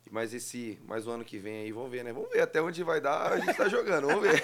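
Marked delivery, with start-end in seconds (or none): 2.1-4.5 laughing. Real, not acted. Guest laughs at own joke.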